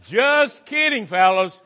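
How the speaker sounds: high, slow, playful just kidding; a teasing high-pitched take. Shown as a demonstration. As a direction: sing it up high like a tease. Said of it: "I say that just teasing".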